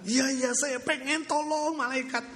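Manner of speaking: high, slow pleading yes; eager begging. Acted. Wife eagerly begging the angel for wealth, high pleading.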